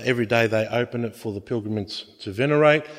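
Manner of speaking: normal talking voice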